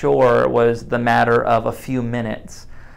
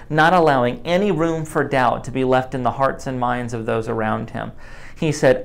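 normal talking voice

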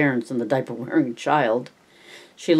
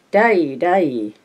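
put-on slow, babyish "tai tai" for "Daddy"; toddler's call; 3 semitones higher, 3 dB louder than usual. A narrator's reading. Toddler calling to his father, slow and babyish. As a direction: lisp it like a small child.

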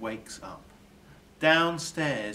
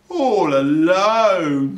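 slow, drawn-out, louder pity; 6 semitones higher, 12 dB louder, much slower than usual. A narrator's reading. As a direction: stretch it out with sympathy. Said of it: "says Burglar Bill".

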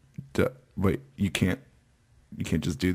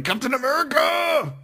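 high, full mock shout of the hero's name; fan shout. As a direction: yell it like a superfan in the theater. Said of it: "shirt going".

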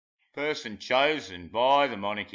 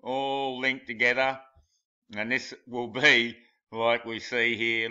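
normal talking voice

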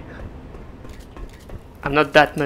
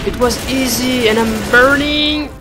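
higher, louder, slow alarm; panicked; 7 semitones higher, 4 dB louder than usual. Real, not acted. Player realizing he's burning, higher panicked cry. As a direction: cry out, half laughing in panic.

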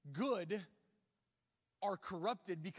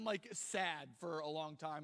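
normal talking voice

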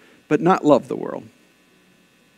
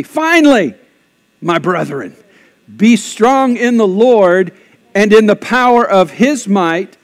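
loud, slow, raised public reading; scripture declaimed; 3 semitones higher, 6 dB louder, slower than usual. Real, not acted. Pastor leads congregation reading scripture out loud, declaimed. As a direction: proclaim it slowly and forcefully for all to join in.

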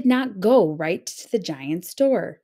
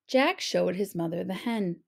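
ordinary delivery

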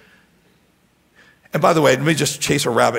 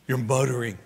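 low, soft mutter of the word; 4 semitones lower, 6 dB quieter than usual. Shown as a demonstration. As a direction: let MUTTERING drop into a mumble. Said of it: "When you say the word, you do the thing".

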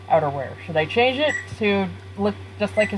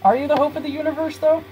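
high, quick, needling question; pestering underling; 8 semitones higher, faster than usual. Acted. Underling pressing the boss on his claim, eager. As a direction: keep badgering in a high sidekick voice.

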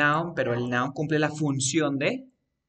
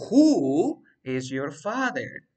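teacher's drawn-out emphasis on "Who"; stress on WHO; 5 dB louder, much slower than usual. Shown as a demonstration. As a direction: hold "Who" to hint at the answer. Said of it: "Mira, yo te digo".

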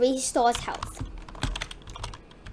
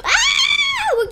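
very high, loud shriek; high startled scream; panicked scream; 5 dB louder than usual. Real, not acted. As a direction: scream in sudden game panic. Panicking in the game.

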